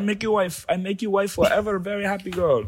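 high-pitched imitation Indian accent; mock Indian accent. Playing a polite online suitor, mock Indian accent. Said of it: "They're always polite".

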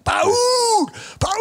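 very high, drawn-out imitated holler; screaming for someone. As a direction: imitate a far-carrying yell in a thick Guatemalan accent. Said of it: "he's got this thick accent".

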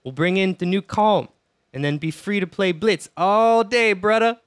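lightly held mock Jamaican accent; bad Jamaican accent. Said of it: "really shitty Jamaican accent".

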